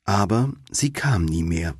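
plain reading voice

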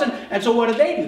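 usual speaking voice

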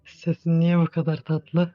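slow, loud faked man voice; forced deep voice. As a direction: drawl it like a guy. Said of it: "Ben de kız olup erkek sesi çıkarıyorum".

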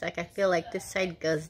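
speaking around fingertip; finger touching teeth. Real, not acted. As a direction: finger against front teeth. Seen 0: Fingertip on front teeth from 0.2 to 1.4s, later lip corner.

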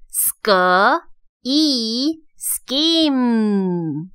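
"scheme" built from "sk", long "ee", then drawn out whole; sounding out. Shown as a demonstration. Teacher breaks scheme down for learners, sounding out slowly. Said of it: "所以是念成e".

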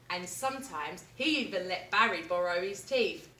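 ordinary delivery

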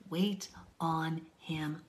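slow breathy whisper; hushed slow whisper. Real, not acted. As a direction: whisper it slowly and patiently. Whispered repeat.

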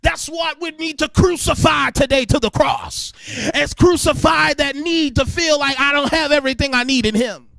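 loud, ringing sermon cadence; preaching at full force. Acted. Preacher calling out to the congregation in full force. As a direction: preach it with rising fire.

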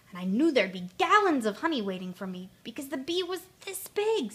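excited boast. Acted. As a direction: marvel at the size of it. Pooh recounting his giant honeybee.

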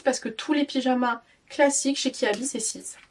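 ordinary delivery

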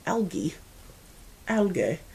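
English pronunciation with a hard g; English accent. Retold or imitated. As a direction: say "Algae" as an English friend would, hard g. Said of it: "a friend who is from England".

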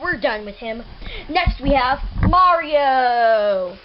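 ordinary talk

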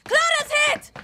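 horrified shouts; anguished shouting. Acted. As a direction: scream as she falls. Comrade shot, panic.

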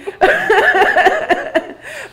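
high burst of real laughter; a real high-pitched laugh. Real, not acted. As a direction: let a high laugh spill out. Laughing at painful memory.

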